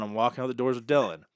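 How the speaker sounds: normal talking voice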